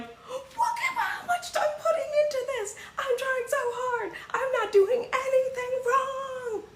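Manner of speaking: a hammed-up wail of the misunderstood. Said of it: "They're like".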